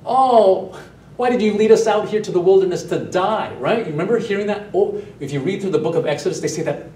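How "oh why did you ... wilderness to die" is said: whiny complaint. Retold or imitated. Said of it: "groaning and moaning and complaining".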